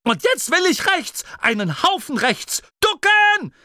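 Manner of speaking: high-pitched urgent shouting; panicked orders at top pitch; frantic commands. A narrator's reading. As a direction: demand the turn, then scream a warning. Frantic order, then warning to duck.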